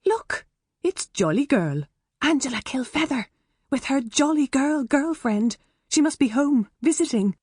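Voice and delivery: mild hissed gossip building in excitement; a touch of thrilled whispering; throbbing excitement. A narrator's reading. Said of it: "hissed in a voice throbbing with excitement".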